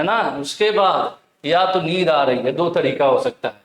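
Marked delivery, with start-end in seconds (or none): none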